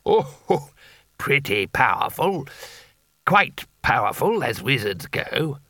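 lightly voiced hearty wizard humouring a child; a hint of a jolly fat wizard, slow at first; jovial old wizard. Bilias humours the boy.

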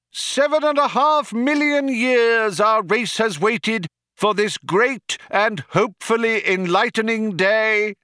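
high-pitched rally oratory; crying out. A narrator's reading. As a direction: whip up the crowd with a ringing proclamation. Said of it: "cried the cheerleader".

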